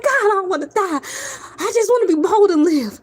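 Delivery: high, fast mock panic; mock panicked plea. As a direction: rush it out like you're scared to die. Retold fear of vampires.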